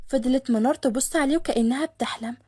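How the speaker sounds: ordinary delivery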